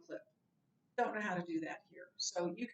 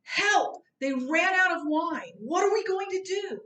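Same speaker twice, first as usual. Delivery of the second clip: high, loud frantic plea for help; mock-frantic alarm, much higher and louder; panicked cry; 8 semitones higher, 10 dB louder than usual. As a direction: cry out as if the party is ruined. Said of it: "So in her panic".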